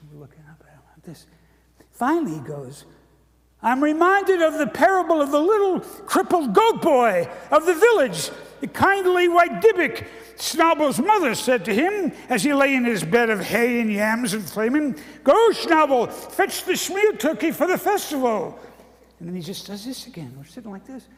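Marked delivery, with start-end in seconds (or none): 0.1-1.3 whispered aside. Real, not acted. 3.6-18.6 put-on high, loud, strained old rabbi voice; hammed-up ancient rabbi telling a parable. Ancient rabbi tells the kids a parable, quavering and loud.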